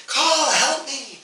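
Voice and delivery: distressed bird creature with a human voice; pleading crow-person. Acted. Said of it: "It's a human voice repeating".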